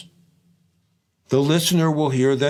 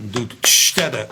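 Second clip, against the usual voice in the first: "ch" hissed for the open hi-hat; scatted groove with the hat opened on "ch". Shown as a demonstration. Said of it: "open the hi-hat on the and".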